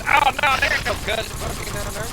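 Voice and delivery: put-on mom's southern accent. Mom denying the cousin rumors about Alabama, imitated southern accent. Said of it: "In that accent, she said".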